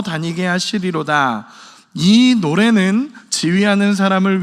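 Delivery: loud, measured congregational Bible reading; scripture reading. Real, not acted. Preacher leading the congregation in reading Habakkuk's song, solemn loud recitation. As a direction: proclaim the verses slowly and firmly, phrase by phrase.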